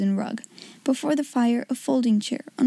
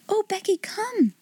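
eager hushed invite; 9 semitones higher than usual. Acted. Sarah beckoning.

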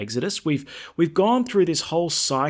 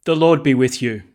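greeting projected louder; liturgical greeting; 7 dB louder than usual. Real, not acted. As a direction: address the whole church. Priest's greeting at Mass.

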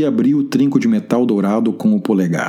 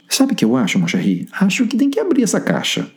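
playful friend voice; 10 semitones higher than usual. Acted. Wagner's flamboyant endearments to Ruth.

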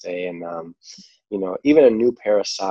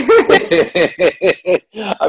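bright burst of laughter; laughing; 11 semitones higher, 7 dB louder than usual. Real, not acted. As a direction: laugh out loud. Laughing at accent joke.

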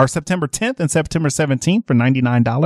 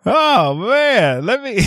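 ordinary talk, nothing marked

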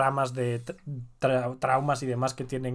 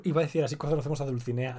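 usual speaking voice

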